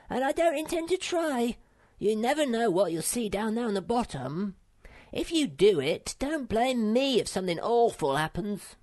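high, bossy little creature giving orders; bossy little Sniff. Acted. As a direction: play the self-important path pioneer, pitched high. Sniff voiced in story.

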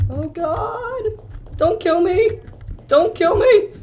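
high squeaky plea, rising at the end; fearful high-pitched whimpering plea; frightened pleading. Real, not acted. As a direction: beg in a very high scared voice. Pleading while hunted in horror game.

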